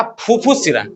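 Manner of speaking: ऊँचे सुर में बार-बार रटवाएँ; सुर बारी-बारी चढ़ाकर और गिराकर दोहराव; रटवाने का लहजा. Shown as a demonstration. शिक्षक शब्द रटवा रहा है. (कक्षा को शब्द रटवाता ऊँचा, लयदार लहजा)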